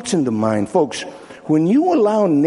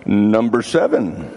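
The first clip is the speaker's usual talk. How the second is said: ordinary delivery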